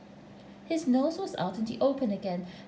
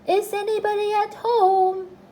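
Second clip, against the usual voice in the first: high, loud singsong call; long, ringing call on a high note; 9 semitones higher, 7 dB louder than usual. A narrator's reading. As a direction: call into a hole to see who's in. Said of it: "called out".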